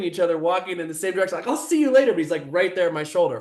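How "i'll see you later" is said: high-pitched mock farewell; pitched-up, sing-out send-off; mocking brush-off. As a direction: parody your own dismissive goodbye. Mocks his own earlier brush-off.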